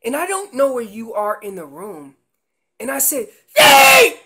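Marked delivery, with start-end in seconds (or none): 3.6-4.2 yelled hard and high, calling across the woods; overdone shout of a lost caller; loud yell. Shown as a demonstration. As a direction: bellow it out to someone you can't see. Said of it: "You don't wanna do that".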